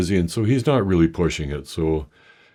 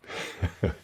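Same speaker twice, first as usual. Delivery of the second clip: burst of laughter; 9 dB quieter than usual. Real, not acted. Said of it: "I burst out laughing".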